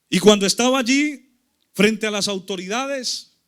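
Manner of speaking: loud, measured pulpit delivery; forceful sermon retelling; emphatic preaching. Real, not acted. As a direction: preach it out phrase by phrase, with weighty pauses.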